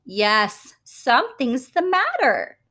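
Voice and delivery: usual speaking voice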